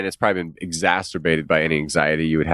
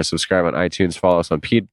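ordinary delivery

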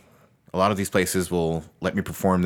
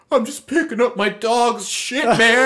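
put-on teen kid's reply; 13 semitones higher, 4 dB louder, slower than usual. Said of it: "holds up a shovel and goes".

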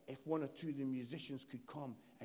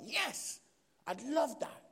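high, punchy burst of delight; eager yes; 8 semitones higher, 7 dB louder, slower than usual. Retold or imitated. Preacher retelling his answer to the board, eager. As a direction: leap at the offer, pitched up and louder. Eagerly accepts offer in story.

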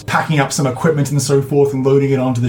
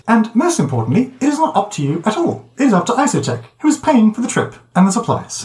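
high, prim protocol droid; a high, officious droid voice; droid piping up; 8 semitones higher, faster than usual. Acted. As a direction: pipe up, fussy and proper. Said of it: "pipes up and says".

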